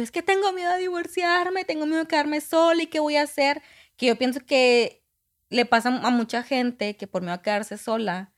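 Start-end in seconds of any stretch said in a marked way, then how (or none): 0.0-3.6 tearful plea. Retold or imitated. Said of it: "Y yo llegué llorando".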